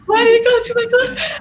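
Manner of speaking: high, laughing squeak of disbelief; mock incredulous squeal through laughter; baffled disbelief. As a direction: ask it in giggling shock. Retold baffled question, laughing.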